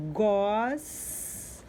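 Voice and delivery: slow drawn-out "gos" with a hissing final S; teacher sounding out "gos" for children; syllable demo. Shown as a demonstration. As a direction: stretch out "gos" so the S at the end is heard. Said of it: "no final da sílaba go".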